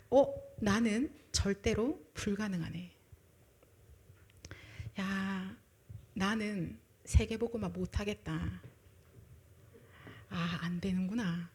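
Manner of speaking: slow, soft, defeated admission; resigned realization. Retold or imitated. Preacher voicing someone realizing they cannot, quietly resigned. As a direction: sigh out the giving up. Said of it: "알게 된 거".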